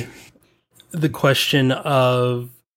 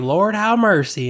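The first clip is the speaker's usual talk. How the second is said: mock Southern grandparent exclamation, pitched up; teasing, higher-pitched Southern twang; 6 semitones higher than usual. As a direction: imitate Southern grandparents' accent. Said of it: "very Southern sound".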